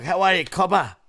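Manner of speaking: broad, run-together Australian greeting. Shown as a demonstration. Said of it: "real redneck Aussie terms".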